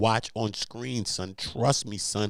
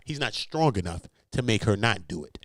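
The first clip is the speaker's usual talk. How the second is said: ordinary talk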